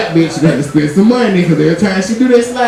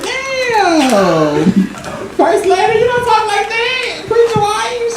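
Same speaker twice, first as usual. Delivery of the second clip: shocked scolding; 13 semitones higher, much slower than usual. Retold or imitated. Scolding his mama in retold call.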